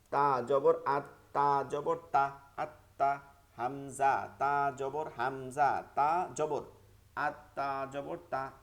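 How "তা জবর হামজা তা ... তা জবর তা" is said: slow spelling drill. Shown as a demonstration. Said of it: "বানানটা আমার সাথে কয়েকবার করেন".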